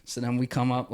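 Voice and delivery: normal talking voice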